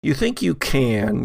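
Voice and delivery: ordinary talk, nothing marked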